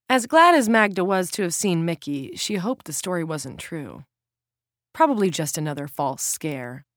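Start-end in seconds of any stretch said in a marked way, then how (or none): none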